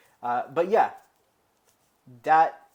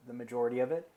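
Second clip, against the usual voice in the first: ordinary talk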